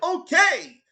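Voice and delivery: "Okay" shouted high and loud, Japanese style; imitating Terry. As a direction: bark out "Okay" like a Japanese voice actor. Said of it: "He's like".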